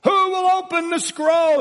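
high, loud angelic cry; ringing, sustained herald's shout; angel's voice. Acted. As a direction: call out over the noise of weeping. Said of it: "the voice of the angel".

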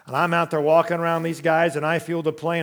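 ordinary talk, nothing marked

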